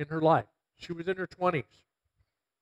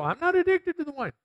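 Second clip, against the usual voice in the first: high, indignant mock protest; mocking defensive drinker; 12 semitones higher than usual. People brushing off the verse, mock indignant. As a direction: squeak it out like a touchy denier. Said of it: "And I know people go".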